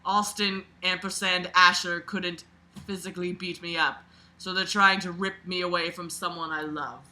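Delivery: usual speaking voice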